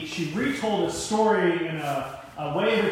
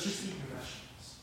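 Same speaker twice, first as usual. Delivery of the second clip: much softer, low and weary; resigned plea; 4 semitones lower, 17 dB quieter than usual. Acted. Parent turning back with the spinach plate, quiet resigned plea. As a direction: drop right down to a gentle, giving-in murmur. God relents after the outburst.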